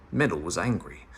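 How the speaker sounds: ordinary delivery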